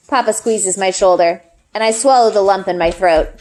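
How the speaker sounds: plain reading voice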